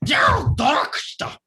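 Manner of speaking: slow, high, booming dragon roar; dragon shout. Acted. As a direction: bellow it like an angry dragon king. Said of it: "hear this as".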